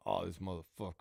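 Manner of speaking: low, soft, fed-up mutter under the breath; quiet exasperated curse; disgusted mutter. Shown as a demonstration. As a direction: say it like an inmate just ruined your day. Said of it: "you tell me how I felt about it".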